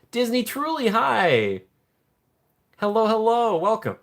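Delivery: high, bright welcome; excited greeting. Real, not acted. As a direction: greet them with delight. Greeting a chatter.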